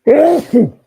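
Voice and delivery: one loud sudden sneeze; sneezing. Real, not acted. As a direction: let a real sneeze burst out. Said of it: "Forgive me, I'm gonna sneeze".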